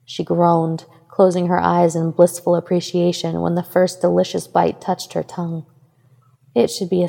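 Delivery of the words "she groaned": usual speaking voice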